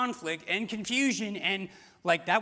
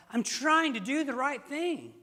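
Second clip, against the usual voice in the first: higher, slowed plea of frustration; exasperated; 7 semitones higher than usual. Retold or imitated. Believer rebuffed for doing right, higher exasperated plea. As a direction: whine it like you're fed up. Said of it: "You're like".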